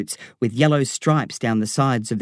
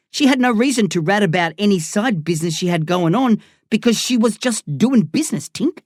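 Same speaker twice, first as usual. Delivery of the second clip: Darren, cocky gangster; 5 semitones higher than usual. Acted. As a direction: rib your old mate with swagger.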